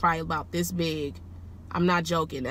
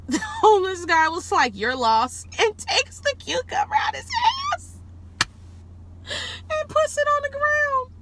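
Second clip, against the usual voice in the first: high squeal of laughter through the words; cracking up, voice shooting way up; helpless laughter. Real, not acted. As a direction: barely get it out for laughing. Cracking up telling the punchline.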